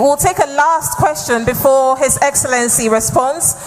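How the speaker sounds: normal talking voice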